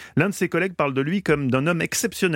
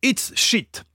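tense copilot; 7 semitones higher than usual. Acted. Copilot's line acted out.

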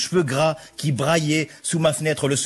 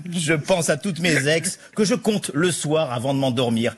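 ordinary delivery

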